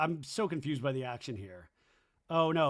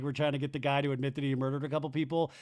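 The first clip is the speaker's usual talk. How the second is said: normal talking voice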